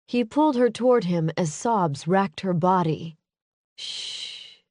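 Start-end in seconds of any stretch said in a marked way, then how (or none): 3.8-4.6 breathy hush, almost silent; soothing shush. Acted. Adam comforting sobbing Maisie, hushed shush. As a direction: shush her gently.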